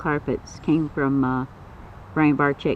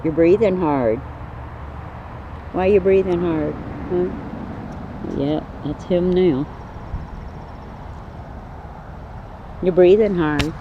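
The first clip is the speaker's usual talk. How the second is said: pet talk; 3 semitones higher than usual. Real, not acted. Asking snake why it huffs.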